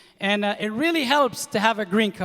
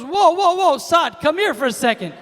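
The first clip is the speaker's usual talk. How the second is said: put-on loud, high whoa to halt a kid; mock alarmed camp counselor shouting; alarmed calling out; 7 semitones higher, 5 dB louder than usual. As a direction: counselor yelling to stop him. Counselor stops the threat.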